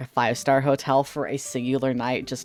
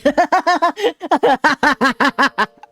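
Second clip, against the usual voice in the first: high loud cackling laugh; loud laughter; 11 semitones higher, 6 dB louder than usual. Real, not acted. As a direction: burst out cackling. Laughs at own joke.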